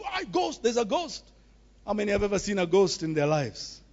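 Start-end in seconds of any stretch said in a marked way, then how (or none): 0.0-1.2 frightened shout. Retold or imitated. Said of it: "they shouted".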